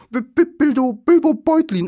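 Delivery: very high, loud, panicky stutter; terrified stammering Bilbo. A narrator's reading. Captured hobbit giving his name to trolls, terrified stammer. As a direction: stammer through chattering teeth. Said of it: "sagte der arme Bilbo und klapperte mit den Zähnen".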